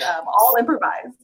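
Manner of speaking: ordinary talk, nothing marked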